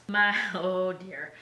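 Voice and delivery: usual speaking voice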